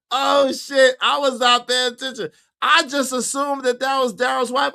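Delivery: high, laughing admission; amused disbelief. Real, not acted. Host admitting his mistake to chat, amused. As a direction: shake your head at yourself.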